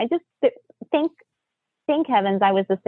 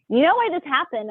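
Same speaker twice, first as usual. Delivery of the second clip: a touch of retold anger, pitched higher; mildly angry; 8 semitones higher than usual. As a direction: rhetorical jab at the husband, mildly irritated. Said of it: "I'm all angry".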